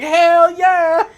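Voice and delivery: loud, high hammed-up cheer; mock eager yell. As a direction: belt it like a thrilled monster. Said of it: "she's like".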